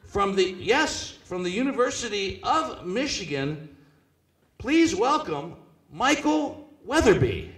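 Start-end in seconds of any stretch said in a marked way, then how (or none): 4.6-7.5 announcer intro. Acted. Introducing guest.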